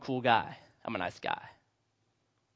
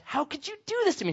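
put-on hurt girlfriend; 11 semitones higher, 4 dB quieter than usual. Girlfriend upset in story.

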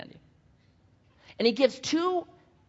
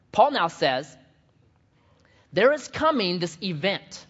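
ordinary talk

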